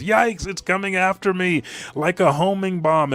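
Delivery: high, loud cartoon panic; comic alarm. Luffy fleeing homing flames, comically alarmed. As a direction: yelp it out as if being chased.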